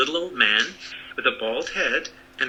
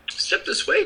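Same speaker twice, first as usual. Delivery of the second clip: little man voice. Acted.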